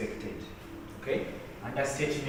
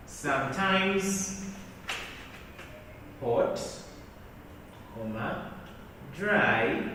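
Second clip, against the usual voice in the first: slow dictation; 8 dB quieter, slower than usual. Shown as a demonstration. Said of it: "someone can say".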